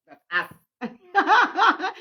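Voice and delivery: high laughing "that's us". Retold or imitated. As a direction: cackle the words with glee. Ancestors laughing reply.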